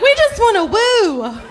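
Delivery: high, hammed-up whoop of a party crowd; mock Southern partier. Mocking the locals who just want to party, hammed-up cheer. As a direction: squeal it like a rowdy Southern crowd. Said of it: "You're like".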